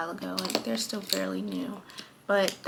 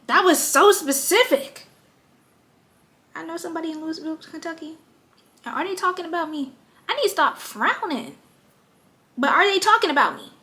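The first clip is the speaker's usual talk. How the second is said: higher, louder, fast playful rambling; excited high chatter; 7 semitones higher, 9 dB louder, faster than usual. Real, not acted. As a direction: riff excitedly, building the joke. Joking the eyebrows gossip about her.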